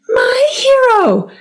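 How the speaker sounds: high, slow breathless damsel voice; swooning damsel. Acted. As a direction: sigh it out like a rescued heroine. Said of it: "a victim".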